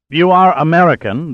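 high, loud blurt in broken English; astonished blurt. A narrator's reading. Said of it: "the stranger blurted in broken English".

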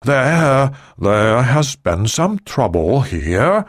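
slow, halting, stretched-out confession; reluctant admission. A narrator's reading. Ri admits trouble to the President, reluctant. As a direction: restart the word, then drag it out. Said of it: "Ri admitted".

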